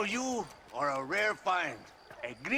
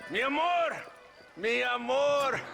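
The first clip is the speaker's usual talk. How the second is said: higher, louder, long-drawn holler; amorous call; 6 semitones higher, 4 dB louder, slower than usual. Acted. Colonel calls for his love, amorous holler. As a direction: sing out to a lover across the house.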